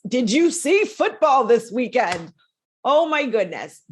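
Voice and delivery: bright eager question, slowed; gushing about the big game; excited. Real, not acted. Excited sports aside.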